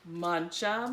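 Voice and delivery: plain reading voice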